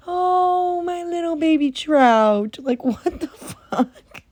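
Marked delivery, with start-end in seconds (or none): 0.1-2.6 put-on high, cooing baby talk to little Trout; mock cooing parent. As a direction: coo it sweetly like a doting mom. Cooing over baby named Trout.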